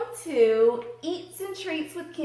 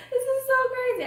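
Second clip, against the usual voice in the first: laughing excitedly; 14 semitones higher, much faster than usual. Real, not acted. Excited before first bite.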